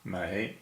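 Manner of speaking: "Mei" sliding upward in pitch; rising tone. Shown as a demonstration. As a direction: demonstrate Mandarin second tone on "Mei". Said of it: "a rising tone".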